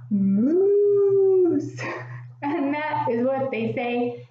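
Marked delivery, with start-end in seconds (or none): 0.1-1.8 loud, long moose bellow; moose call. Shown as a demonstration. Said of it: "And what do they say?".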